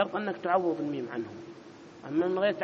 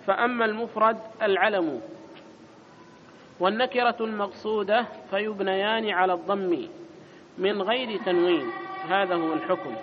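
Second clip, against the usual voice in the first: raised, louder recitation of the book; reading the text aloud; 4 semitones higher, 4 dB louder than usual. Acted. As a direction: read the passage out like a formal recital.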